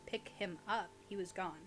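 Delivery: normal talking voice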